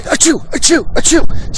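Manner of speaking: three high, loud fake sneezes; loud, high, theatrical achoo; mock sneezes. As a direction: ham up a sneezing fit. Acting out Baldwin's sneezing.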